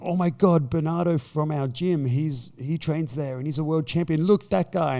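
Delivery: high, loud starstruck gushing; imitating thrilled gym mates; excited gushing. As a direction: gush like awestruck fans. Students hyped about gym champion.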